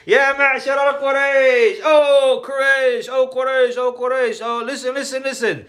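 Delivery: retold scream. Jamil announcing to all Quraysh, imitated scream. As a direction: call it out high, imitating a town crier. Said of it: "he screamed at the loudest voice".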